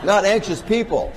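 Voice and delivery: high, loud laughing denial. Real, not acted. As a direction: laugh it out, protesting with amusement. Laughing denial of mocking anxious people.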